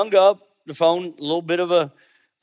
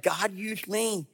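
awed; 4 semitones higher, 9 dB quieter, slower than usual. Retold or imitated. Awed retold exclamation.

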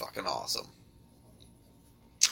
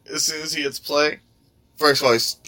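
normal talking voice

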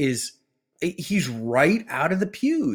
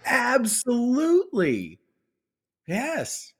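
much higher, slow, drawn-out absolutely; emphatic absolutely; 8 semitones higher, slower than usual. Real, not acted. Answering the laughing co-host, a slow emphatic absolutely. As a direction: lean on it, sure of yourself.

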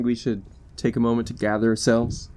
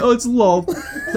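mock horror; 12 semitones higher, 5 dB louder, much slower than usual. Mock dread at spider goddess.

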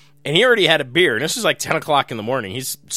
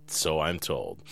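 low, quiet mock aside; deep mock aside; 9 semitones lower, 9 dB quieter than usual. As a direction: drop way down for the aside.

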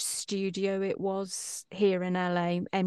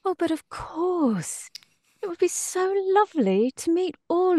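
Professor Garlic; 4 semitones higher than usual. Pretend. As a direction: play a sweetly serene, unhurried professor. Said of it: "say hi to the people around the world watching as Professor Garlic".

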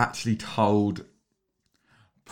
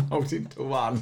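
normal talking voice

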